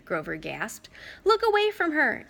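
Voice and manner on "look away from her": high urgent shout. A narrator's reading. Girl warning her friends, shouting. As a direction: yell the warning hard. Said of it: "Annabeth shouted".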